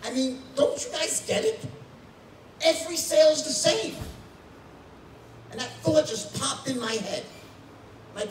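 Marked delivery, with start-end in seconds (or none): 0.0-4.0 rising higher and louder into the big reveal; a retold rant swelling to a shout; building excitement. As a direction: start urging and build to the breakthrough. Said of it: "I'm like".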